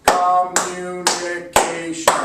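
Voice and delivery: "Communication" very slow and loud in five beats, "Com-mu-ni-ca-tion"; slow syllable split. Shown as a demonstration. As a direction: clap all five parts of "Com… mu… ni… ca… tion". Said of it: "I'll clap with you".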